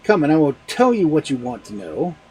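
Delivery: menacing call. Acted. Husband about to beat wife.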